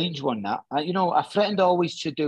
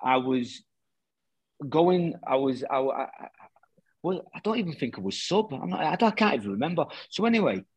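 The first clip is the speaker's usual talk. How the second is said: ordinary talk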